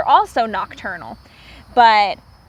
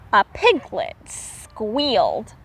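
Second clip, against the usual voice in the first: usual speaking voice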